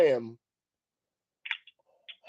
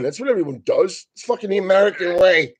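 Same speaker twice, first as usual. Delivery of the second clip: normal talking voice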